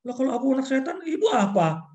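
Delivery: high, loud smart-aleck comeback; child answering back; cheeky child retort. Retold or imitated. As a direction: throw the insult back at mom. Child talks back to parent.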